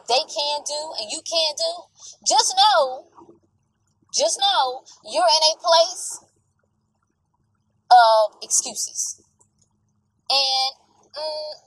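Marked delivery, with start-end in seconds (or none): 2.3-9.2 high, slow and stressed; slow emphatic. Real, not acted. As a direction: lean hard on each word. Emphatic warning.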